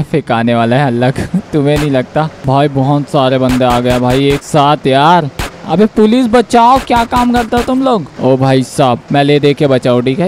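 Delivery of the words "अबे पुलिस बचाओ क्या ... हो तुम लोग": high-pitched call for help; indignant, high cry for rescue; exasperated plea. Real, not acted. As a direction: call out to the police, voice pitched well up. Calls police for help during chase.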